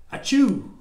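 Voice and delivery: a big hammed-up sneeze, high and loud; loud mock sneeze. Reading to a child, a loud playful pretend sneeze.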